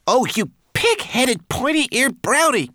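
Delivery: very high, loud, slowed exasperated insult; tiny creature crying out in desperation; desperate cry. A narrator's reading. Homunculus insults the stubborn brownie, desperate. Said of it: "cried Twigleg desperately".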